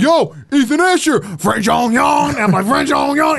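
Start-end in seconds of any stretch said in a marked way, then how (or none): 0.0-2.0 excited fans. Retold or imitated. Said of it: "be like". 2.7-3.4 high French-caricature catchphrase; French accent. Retold or imitated. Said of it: "It was, like, my catchphrase".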